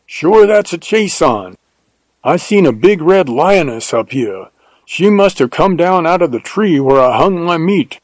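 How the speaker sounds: keyed-up Texan telling news; excited. A narrator's reading. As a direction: report the find eagerly in a Texas voice. Jim excitedly reports a lioness.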